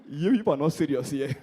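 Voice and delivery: ordinary talk, nothing marked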